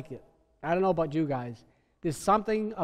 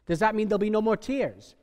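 ordinary delivery

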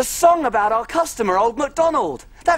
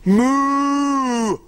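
cow moo; 4 semitones higher than usual. Pretend. Farm animal noises game.

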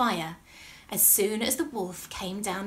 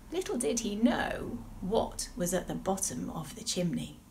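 ordinary delivery

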